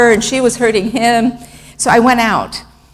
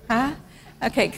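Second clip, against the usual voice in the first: usual speaking voice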